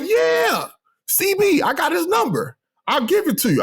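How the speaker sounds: high-pitched imitation of an eager yes; eager mock reply. Retells supervisor's eager reply.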